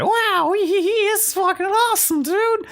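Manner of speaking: squeaky, very high mock-childish glee; way up high, hammed-up delight; giddy glee. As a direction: giggle it out in a silly falsetto. Imitating own childish glee in snow.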